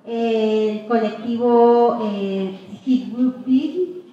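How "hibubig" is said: usual speaking voice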